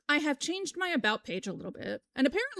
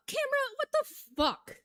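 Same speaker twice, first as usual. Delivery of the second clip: surprised. Real, not acted. Annoyed at camera.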